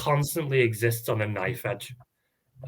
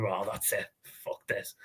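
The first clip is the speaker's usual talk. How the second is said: softer, fading mock mutter; muttered giving up; 3 semitones lower, 11 dB quieter than usual. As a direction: mutter it like you've given up on the match. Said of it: "and you'll go".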